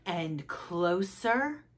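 slow, soft, drawn-out "closer"; creeping suspense. A narrator's reading. Froggy scoots toward the story, soft slow creeping. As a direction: creep in quietly on each word.

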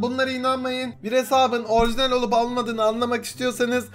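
ordinary talk

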